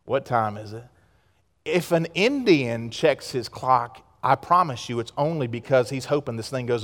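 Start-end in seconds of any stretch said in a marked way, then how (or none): 0.0-0.8 low, soft, listless; imitating apathy, voice dropped; mock bored worshiper. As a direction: sag into it like someone waiting to leave. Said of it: "we're just kinda like".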